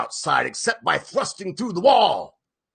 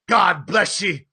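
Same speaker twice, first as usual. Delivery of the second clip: louder cry with a hint of a sob; half sob half shout; 6 semitones higher, 5 dB louder than usual. A narrator's reading. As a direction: bless them, choked up and loud. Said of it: "he seemed to half sob and half shout".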